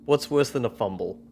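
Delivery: normal talking voice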